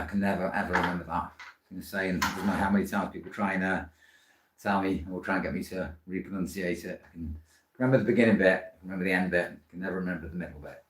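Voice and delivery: normal talking voice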